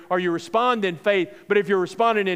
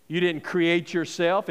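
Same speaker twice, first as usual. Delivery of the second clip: usual speaking voice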